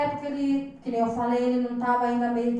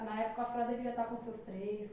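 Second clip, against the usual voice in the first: normal talking voice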